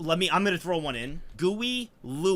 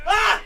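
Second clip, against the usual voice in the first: sudden high shriek of disgust; loud grossed-out scream; startled scream; 11 dB louder than usual. Real, not acted. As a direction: yelp like something jumped out. Startled by creepy toy.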